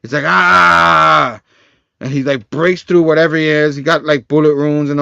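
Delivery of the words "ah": loud, long raised "Ah!"; mock roar, held out; imitated battle yell. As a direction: yell it and sustain the vowel. Imitates Digger breaking through.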